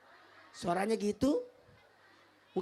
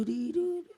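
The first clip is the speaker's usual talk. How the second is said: nasal-free high camel grunt imitation; camel call; 4 semitones higher than usual. Shown as a demonstration.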